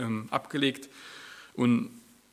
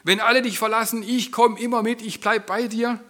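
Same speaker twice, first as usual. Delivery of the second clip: much higher and louder, bursting with zeal; euphoric vow; 10 semitones higher, 10 dB louder than usual. Retold or imitated. Peter vowing loyalty to Jesus in Gethsemane, euphoric. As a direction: promise it with overflowing enthusiasm. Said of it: "Voller Euphorie".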